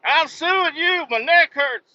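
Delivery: mock a driver faking a neck injury; whiny claimant. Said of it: "and they're like".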